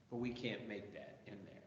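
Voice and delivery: usual speaking voice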